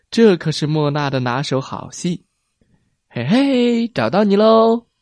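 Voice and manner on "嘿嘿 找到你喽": playful call. A narrator's reading. Hide and seek game.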